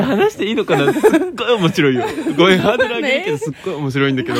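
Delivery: fast, high, laughing hype; excited. Real, not acted. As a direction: rush it out, giddy. Excitedly hyping up a story.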